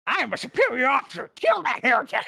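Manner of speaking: high, quick shouted command; Leroy firing back. Acted. Officer answering the guardsman back, high shouted order. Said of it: "Leroy is immediately gonna shoot back".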